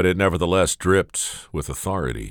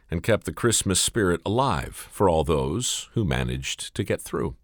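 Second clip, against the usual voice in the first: ordinary delivery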